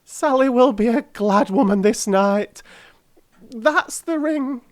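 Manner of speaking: put-on high, pleading old crone voice; a poor old charwoman begging humbly; old woman. Old woman begs the gentlemen for the ring, high and humble.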